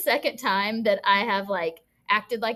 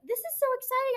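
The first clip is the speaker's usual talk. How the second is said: high-pitched mock thrill; feigned excitement; 13 semitones higher, faster than usual. Teacher pretending she's invited to kid's party, thrilled. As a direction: gush as if truly invited.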